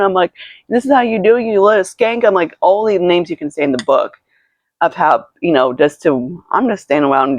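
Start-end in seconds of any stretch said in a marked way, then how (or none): 0.7-2.2 higher-pitched mock insult; retold angry taunt. Retelling a row with a stranger, high angry taunt.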